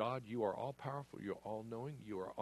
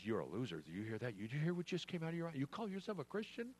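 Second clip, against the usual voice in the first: fast, sneering taunt rising higher at the end; mocking enemy; 5 semitones higher, faster than usual. Preacher voicing Satan taunting a believer, fast mockery. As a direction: jeer at them like the accuser. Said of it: "the enemy comes in and says".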